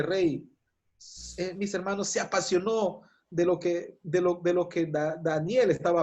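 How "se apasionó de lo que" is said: normal talking voice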